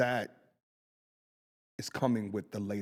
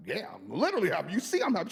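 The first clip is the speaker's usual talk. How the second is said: much higher, mock-insistent protest; insisting I'm happy; 8 semitones higher, faster than usual. [name] insisting to his friend, higher and mock-indignant. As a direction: push back as if it's obvious. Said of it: "I'm like".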